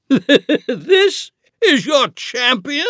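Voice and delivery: slow, high, laughing scorn; mocking giant laugh. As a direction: sneer through a booming laugh. Giant mocks the boy champion.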